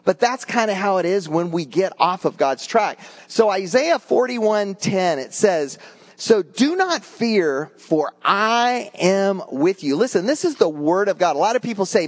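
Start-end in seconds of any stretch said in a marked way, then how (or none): 6.2-10.0 scripture reading. Acted. Reads scripture slowly.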